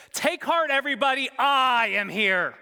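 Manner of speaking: high, loud, slowed proclamation; grand announcing hero. Pretend. Preacher mocking a Moses who never said this, proclaiming loudly. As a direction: boom it out like a self-important savior.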